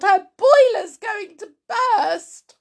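high, loud, frantic; panicked cry building to the last word; wailing. Acted. Wife fears Grandma will burst, wailing in panic. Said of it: "Missus Cranky wailed".

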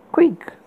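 high breathy creak whispered. A narrator's reading. Creaking sound effect voiced.

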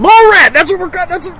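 a sudden high, loud eureka shout; excited shout. Real, not acted. Remembering the cartoon name, an excited high shout.

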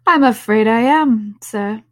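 loud line with a British sir; British accent. Shown as a demonstration. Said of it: "I said sir in a British accent".

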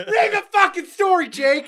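high yell at a friend. Real, not acted. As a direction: snap the order out high. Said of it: "I didn't mean to yell".

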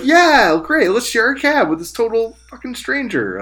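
loud, high, over-keen yes; mock eager agreement. Host mimics naive girls agreeing to share a cab, mock eager. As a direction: gush with sarcastic, high-pitched enthusiasm.

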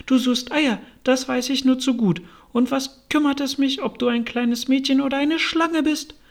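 high-pitched, dismissive insistence; accusing pigeon. A narrator's reading. Pigeon accuses Alice of hunting eggs, dismissive.

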